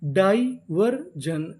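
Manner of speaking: Diversion stretched very slowly, syllable by syllable; drawn-out demo. Shown as a demonstration. Teacher slowly sounding out the whole word for students. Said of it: "पूरी meaning".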